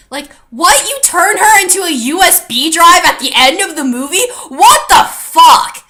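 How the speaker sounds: loud, high exclamation with a laugh, the last words slowed; outraged disbelief. Real, not acted. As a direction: yell it in baffled outrage. Ranting about USB drive ending.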